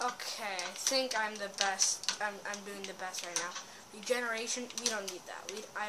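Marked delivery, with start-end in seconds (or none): none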